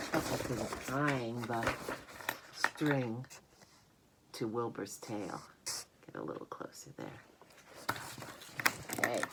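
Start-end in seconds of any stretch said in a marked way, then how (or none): 6.0-7.2 soft whispered aside. Real, not acted. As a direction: whisper it off to the side. Aside to the camera.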